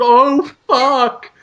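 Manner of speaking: high, drawn-out creature groan; cancer monster. Acted. Cancer slops into the bigger bucket, monster groan. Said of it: "and it just".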